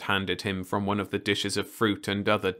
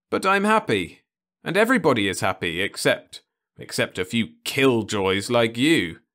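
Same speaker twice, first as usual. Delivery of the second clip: higher, louder, quick jibe; peevish young man; 4 semitones higher, 5 dB louder than usual. Acted. Ludovic jabs at Osmond, quick and louder. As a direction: tease the old killjoy.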